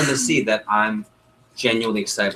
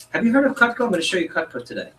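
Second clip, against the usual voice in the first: high, eager sales question; excited pitch; 8 semitones higher than usual. Shown as a demonstration. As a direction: ask it full of enthusiasm. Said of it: "this is how I say it".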